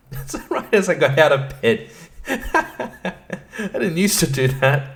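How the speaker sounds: normal talking voice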